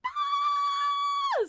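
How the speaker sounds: very high excited scream; high kid scream. Retold or imitated. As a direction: shriek like a kid at a disco. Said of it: "screaming as loud as I could".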